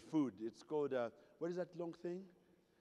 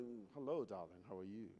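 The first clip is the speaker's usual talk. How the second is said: posh British hello; 8 semitones lower, 10 dB quieter than usual. Retold or imitated. Mimicking the British who raised him, soft and low. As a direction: purr it like a refined Brit. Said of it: "The British that raised me were like".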